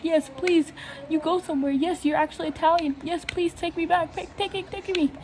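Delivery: high excited pleading; high eager please take me; eager begging. Retold or imitated. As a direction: beg to be taken along, thrilled. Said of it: "I'm like".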